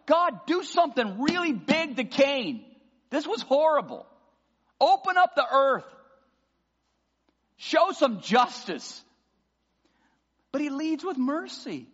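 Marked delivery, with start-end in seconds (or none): 0.1-9.0 high, loud plea to God to act; demanding justice. Retold or imitated. Pastor voicing his own urge to punish Cain, loud plea. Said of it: "I'm like".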